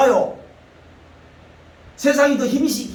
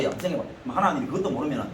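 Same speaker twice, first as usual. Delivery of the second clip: ordinary talk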